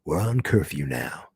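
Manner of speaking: low firm lawman line; sheriff voice. Retold or imitated. Recapping the show, sheriff declares curfew in low voice. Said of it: "That's my sheriff voice".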